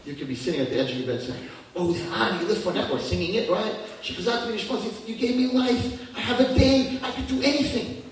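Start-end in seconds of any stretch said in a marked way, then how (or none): 1.7-8.0 high, loud, bubbling with delight; joyful prayer. Pretend. Worshipper thanking God at dawn, overjoyed and loud. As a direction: gush about all the good you can do today.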